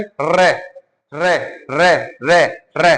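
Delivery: रे said with the tongue tapping the palate several times, rolled; rolled re demo. Shown as a demonstration. Teacher has class say रे to feel the tongue. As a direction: roll रे out so the tongue flicks. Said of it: "उच्चारण करो रे का".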